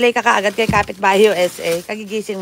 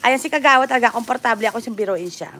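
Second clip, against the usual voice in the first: usual speaking voice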